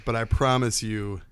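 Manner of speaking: normal talking voice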